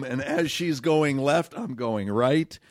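normal talking voice

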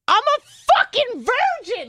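high, loud, drawn-out mock shout; exasperated outburst. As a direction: blurt it out at the end of your rope. Retold exasperated confession to nurse.

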